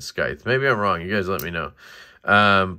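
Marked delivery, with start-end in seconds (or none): 0.0-0.5 scythe said "skythe", with a K; hard C. Shown as a demonstration. Reviewer admitting his habit, scythe with hard C. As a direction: keep the K sound in scythe, "skythe". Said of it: "I think I always have said".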